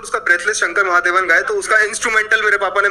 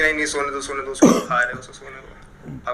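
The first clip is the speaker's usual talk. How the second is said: soft protective mom; 6 semitones lower, 4 dB quieter than usual. Retold or imitated. A hint of mom shielding her sleeping son. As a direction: hush the dad, let him sleep, quietly. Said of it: "पीछे से mummy कहती".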